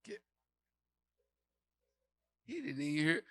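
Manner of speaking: usual speaking voice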